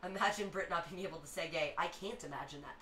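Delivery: ordinary delivery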